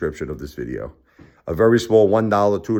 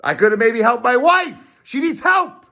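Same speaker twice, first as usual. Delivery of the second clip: high, loud desperate plea; anguished high cry; 6 dB louder than usual. Real, not acted. Pleading for his sick wife, high anguished cry.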